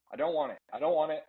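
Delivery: normal talking voice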